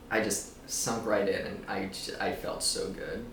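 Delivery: usual speaking voice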